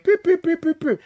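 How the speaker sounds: high chirpy beeps; quick high beeping; keypad beeps. Retold or imitated. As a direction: punch in a code with mouth beeps. Imitating keypad tones.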